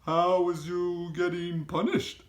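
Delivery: high, loud, odd giant voice with a rough edge of kindness; BFG giant voice. A narrator's reading. Giant asks Sophie if she is snug, high and loud. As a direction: voice the friendly giant fussing over the girl.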